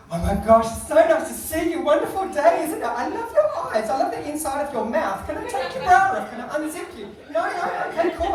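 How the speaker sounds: gushing, high-pitched Australian shop assistant; Australian sales assistant. Retold or imitated. Sales assistant fawning over customer, over-eager Australian gushing. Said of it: "Australian sales assistants are like this".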